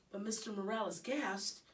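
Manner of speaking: ordinary delivery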